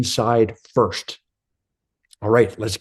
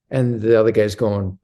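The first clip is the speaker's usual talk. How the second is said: ordinary talk, nothing marked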